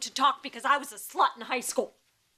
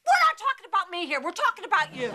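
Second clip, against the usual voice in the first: heated deflection; 6 semitones higher, 5 dB louder, much faster than usual. Acted.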